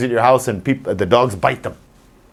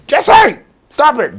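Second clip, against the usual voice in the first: much higher, loud sharp shout; yelling at dog; 12 semitones higher, 5 dB louder, slower than usual. Retold or imitated. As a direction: shout it to stop the digging. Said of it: "why I'm yelling at him".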